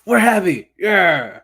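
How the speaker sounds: high, loud playful holler; hammed-up exclamation; mock shout. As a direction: belt it out as a joke. Mimicking an emphatic exclamation.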